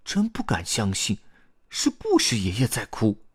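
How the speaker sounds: mildly astonished realisation; surprised. A narrator's reading. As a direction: marvel in a young character voice. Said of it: "卡梅利多惊讶地说".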